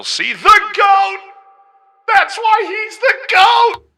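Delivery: squeaky high-pitched shouting. Pretend. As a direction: yell it in a chipmunk voice. Voice changer shout.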